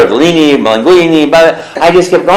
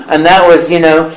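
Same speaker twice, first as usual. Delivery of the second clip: ordinary talk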